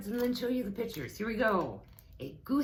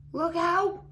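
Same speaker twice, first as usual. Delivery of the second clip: high, loud panicked goose cry; silly goose shrieking help; 12 semitones higher, 8 dB louder than usual. A narrator's reading. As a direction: squawk for help like a frightened goose. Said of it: "cried the goose".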